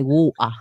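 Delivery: water with a glottal gap and no R; South London 'water'. Shown as a demonstration. Host shows how South Londoners say water, dropped T. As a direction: say water as 'waw-ah', dropping the T. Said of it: "do not pronounce the water very well".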